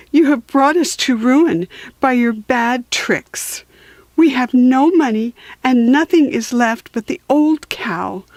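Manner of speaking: a hint of a tearful mother; mild tearful mother. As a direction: scold your son, close to tears. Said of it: "his mother said with tears in her eyes".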